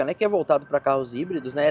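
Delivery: ordinary delivery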